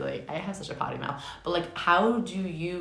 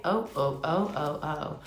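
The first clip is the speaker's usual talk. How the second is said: normal talking voice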